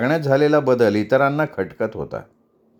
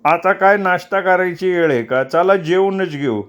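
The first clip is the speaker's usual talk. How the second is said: cutting in; 6 semitones higher than usual. A narrator's reading. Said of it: "मानकू त्याला तोडत म्हणाला".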